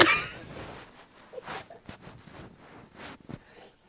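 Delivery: long, high, wheezing laugh; helpless laughter. Real, not acted. After telling of the curler vision, helpless laughter. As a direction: laugh until the breath runs out.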